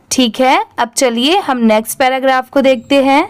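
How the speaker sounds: ordinary talk, nothing marked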